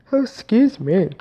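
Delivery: talking through a yawn. Real, not acted. Podcaster yawns mid-story and excuses herself, yawning. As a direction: let a yawn slip into the words. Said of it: "I'm yawning".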